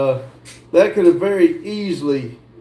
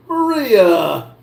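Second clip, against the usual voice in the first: laughing; 4 semitones higher, 3 dB louder than usual. Real, not acted. Laughing at Maria's chat joke.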